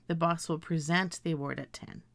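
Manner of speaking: "present" stressed on second syllable; present as pre-ZENT. Shown as a demonstration. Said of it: "I would say".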